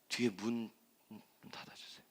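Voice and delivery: very quiet, barely audible request; hushed aside. Real, not acted. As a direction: drop to a near-whisper off to someone aside. Quiet aside asking to close door.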